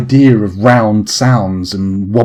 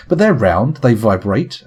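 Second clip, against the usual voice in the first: ordinary talk, nothing marked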